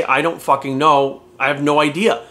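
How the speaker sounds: slow, plain "I don't fucking know"; unbothered slow shrug of a line; slow honest admission. Shown as a demonstration. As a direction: admit it slowly and flatly. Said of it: "It's okay to say".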